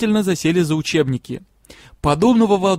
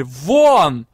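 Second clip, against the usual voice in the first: yell; 5 dB louder than usual. A narrator's reading. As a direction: throw her out with one bellow. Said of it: "кабинет потряс вопль".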